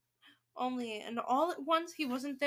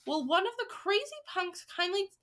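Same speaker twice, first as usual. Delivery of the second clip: put-on high, exasperated boy; mild indignant shout; 7 semitones higher than usual. A narrator's reading. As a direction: demand it in a raised-pitch kid voice. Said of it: "shouted Bruno".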